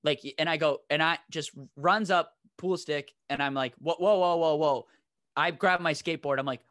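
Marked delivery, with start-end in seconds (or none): 3.8-4.9 loud whoa to hold back a charging man; calming shout. Retold or imitated. To the boyfriend with a pool stick, loud whoas. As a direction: stop him cold, loud and quick.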